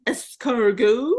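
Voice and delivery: slow, drawn-out American French; foreign accent in French. Retold or imitated. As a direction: mimic a clumsy American saying Escargot. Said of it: "him trying to speak French".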